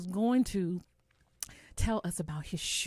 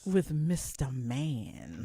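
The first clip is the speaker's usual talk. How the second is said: slow, lower title reading; 6 semitones lower, much slower than usual. Acted. Host reading the show title, slow promo voice. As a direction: stretch it like a promo. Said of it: "You say that part".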